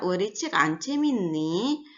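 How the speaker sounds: Korean question lifting on the end of "anceminni"; rising question ending. Shown as a demonstration. Teacher reading the second example question, rising ending. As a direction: let "anceminni" climb at its last syllable. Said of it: "intonasinya agak menaik".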